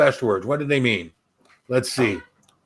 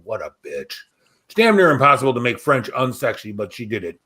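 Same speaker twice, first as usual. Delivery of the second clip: ordinary delivery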